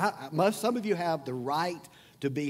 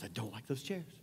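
softer, passed-on gossip, lower, with a rise on "chairs"; gossiping hush; 5 semitones lower, 12 dB quieter than usual. Retold or imitated. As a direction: pass the complaint along under your breath. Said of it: "they tell somebody else".

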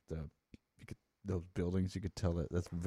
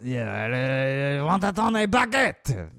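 put-on French accent; 5 semitones higher, 9 dB louder than usual. Mocking French diners.

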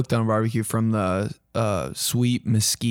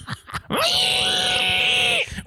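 high squealing pig noise. Pretend. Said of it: "Try to make a pig noise".

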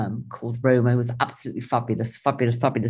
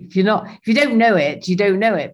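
ordinary talk

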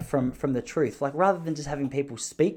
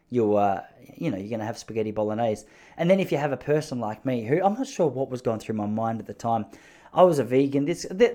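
ordinary talk

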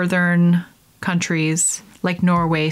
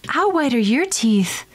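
higher cheesy announcer; mock ad voice; 5 semitones higher than usual. Mocking Crest commercial, cheesy higher ad voice. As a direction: parody a toothpaste commercial. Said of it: "stupid commercials".

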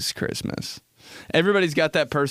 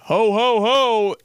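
dad's Santa call; 9 semitones higher, 5 dB louder, slower than usual. Retold or imitated.

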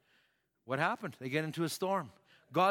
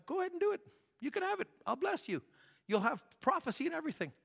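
much higher, light resigned giving-in; voicing God's concession; 12 semitones higher, faster than usual. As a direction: give in like a weary parent. God conceding to Balaam.

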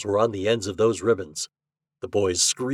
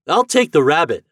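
ordinary delivery

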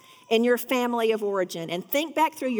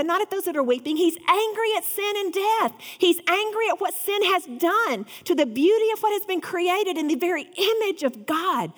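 higher, fervent preaching; impassioned preaching; 7 semitones higher than usual. Acted. Preacher pushes his congregation to feel Jesus' anger, fervent. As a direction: preach it with rising fire.

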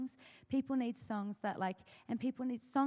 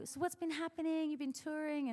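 high-pitched friendly chat; light, high catching-up voice; small-talk greeting; 7 semitones higher than usual. Retold or imitated. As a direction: make small talk in a much higher voice. Acts out small talk with songwriter.

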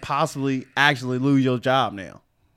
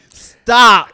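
loud, higher mock yell; retold urgent cry; 8 semitones higher, 13 dB louder than usual. Co-host acts out the girl telling him, retold loud stop. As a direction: call it out sharply louder, as retold.

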